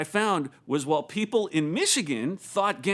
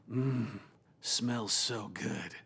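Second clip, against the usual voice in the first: low, slow, savoring a smell; soft, drawn-out relish; savoring Jason; 7 semitones lower, 8 dB quieter, much slower than usual. Retold or imitated. Worship pastor savoring cigarette smoke in a casino, low slow relish. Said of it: "and Jason saying".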